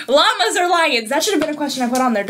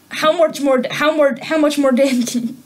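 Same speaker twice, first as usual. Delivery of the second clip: tripping over "how much more", restarting it; stumbling over words. Real, not acted. Trying to ask about Dan, tongue-tied and stumbling. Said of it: "Can you speak today?".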